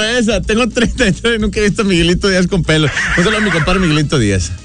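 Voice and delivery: normal talking voice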